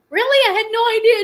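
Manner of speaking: high, loud, astonished "really?"; mock surprise. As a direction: play the mom caught off guard, loud. Mother surprised her son texted.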